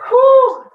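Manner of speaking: high, loud puff of relief; whooped exhale after hard effort; exerted whew. Real, not acted. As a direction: blow out a big high "whew". Exertion during a workout.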